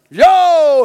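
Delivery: loud, high "Yo"; a sudden, exuberant shout; excited call. Real, not acted. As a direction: hype up the crowd with one word.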